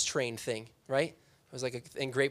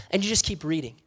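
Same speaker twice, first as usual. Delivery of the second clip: ordinary delivery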